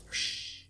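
soft, voiceless whoosh noise; imitated whoosh. Player voices the game's action, soft whoosh. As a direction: make a soft whoosh with your breath.

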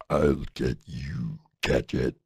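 very low, slow cartoon villain threat; Doctor Claw. Villain threatening Inspector Gadget, deep slow villain voice. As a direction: drop far down and drag out the menace. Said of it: "And that's Doctor Claw from".

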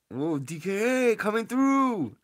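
high, loud, drawn-out whoop; excited cheer. Real, not acted. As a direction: holler it out to hype her arrival. Cheering streamer friend's milestone.

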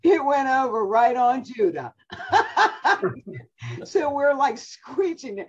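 high, laughing hard through the words; can barely get it out for laughing. Real, not acted. Grandmother telling how the tree fell on Judah, laughing hard.

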